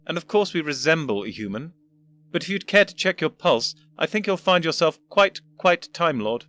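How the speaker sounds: put-on a condescending old professor; pompous old gentleman. First Doctor explains.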